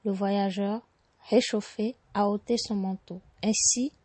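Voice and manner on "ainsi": normal talking voice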